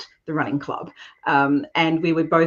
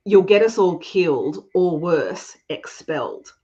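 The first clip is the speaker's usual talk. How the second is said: ordinary talk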